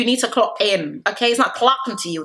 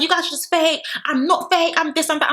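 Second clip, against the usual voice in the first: much higher, whiny mock protest; mock Meg rant; 8 semitones higher than usual. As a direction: squeak out the complaint, breaking into laughter. Meg's rant, voiced mockingly.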